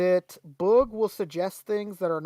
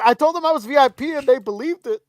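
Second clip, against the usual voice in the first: cocky Billy; 5 semitones higher, 6 dB louder than usual. Retold or imitated. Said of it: "he said".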